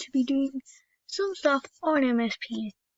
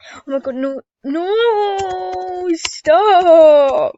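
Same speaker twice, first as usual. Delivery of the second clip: rising, louder cries of no; panicked shout; 4 semitones higher, 10 dB louder, much slower than usual. Real, not acted. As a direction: cry out stop in real dismay. Panicked cry over game item.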